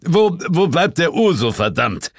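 cross shout at the waiter; impatient call. A narrator's reading. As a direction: bark for the drinks. Boss yells toward the bar.

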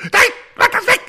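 very high, loud panicked shriek; shaking off a spider. Retold or imitated. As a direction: squeal as if something crawls on you. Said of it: "Also die sagen".

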